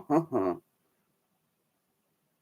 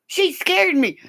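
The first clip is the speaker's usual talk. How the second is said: usual speaking voice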